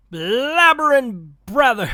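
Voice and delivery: a botched first go at the catchphrase; fumbled Scrooge line. Acted. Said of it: "let me try that again".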